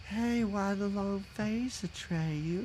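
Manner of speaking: slow, soft, drawn-out mock Empress; the Empress impression, badly off. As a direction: sound like the old lady in Poltergeist. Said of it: "this is her voice".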